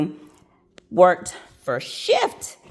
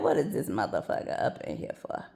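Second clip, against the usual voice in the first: unvoiced, softer muttered aside, fast; whispered aside; 9 semitones lower, 9 dB quieter, faster than usual. Nurse scoffs at the inmate to coworkers, whispered aside. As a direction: hiss it under your breath in disbelief.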